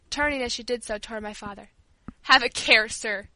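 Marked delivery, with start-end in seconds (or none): 2.2-3.3 mildly indignant warning; a touch of outrage; trembling with indignation. A narrator's reading. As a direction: rebuke him, starting loud and dropping away. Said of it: "her voice trembling with indignation".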